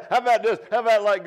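loud, high, cracking up; gleeful laughing. Pretend. Preacher playing out a prank on Pilate, loud high laughing. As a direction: crow it out through big laughter. Playful imagined prank on his executioners.

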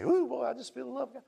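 high, giddy mock rapture; mock blissed-out believer. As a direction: put on a high-pitched swoon, poking fun. Mocks people basking in feeling good.

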